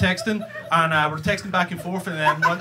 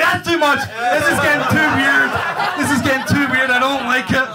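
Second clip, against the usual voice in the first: loud, high-pitched acted freak-out; alarmed protest; 7 semitones higher, 7 dB louder than usual. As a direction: cry out that it's all gone too far. Acted inner panic at her reply.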